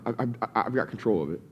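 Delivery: low, quiet, self-satisfied; smug self-assurance. Retold or imitated. Believer telling himself he has it handled, quiet and smug. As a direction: mutter it like a man sure he's in charge.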